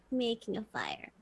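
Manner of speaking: guilty whispered aside. Acted. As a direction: whisper it like a confession.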